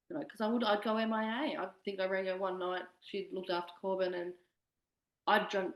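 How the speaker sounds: usual speaking voice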